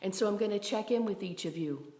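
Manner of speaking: ordinary talk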